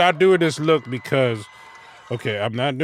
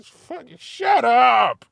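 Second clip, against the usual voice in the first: loud, slow, high shout of disgust; mock yell; 12 semitones higher, 7 dB louder, slower than usual. Yelling at the rapper in the car, mock shout. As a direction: yell it at the speakers.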